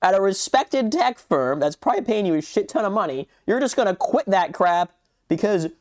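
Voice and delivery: high-pitched, mocking disbelief; worked-up indignant ranting; incredulous rant. Real, not acted. Incredulous rant at character's choice.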